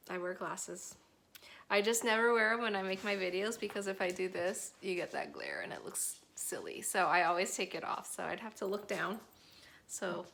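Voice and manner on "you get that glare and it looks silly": unvoiced whisper aside. Real, not acted. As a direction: drop to a whisper. Whispered aside about glasses glare.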